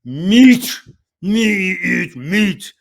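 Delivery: high loud caveman, dragged slow. Retold or imitated. Tom to the flight attendant, slow loud caveman voice. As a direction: drawl it out like a caveman. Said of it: "looks straight at her and says".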